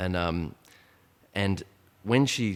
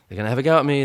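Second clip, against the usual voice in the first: higher and louder, fear spilling over; rising panic; 7 semitones higher, 10 dB louder, much faster than usual. Retold or imitated. Shopper fearing the gang will rob her, panicked outburst. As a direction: let the panic break out in her voice. Panicking about being mugged.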